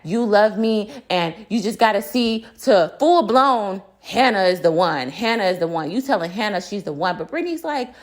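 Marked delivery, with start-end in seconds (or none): none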